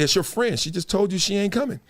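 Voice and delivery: usual speaking voice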